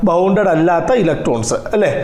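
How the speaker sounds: ordinary delivery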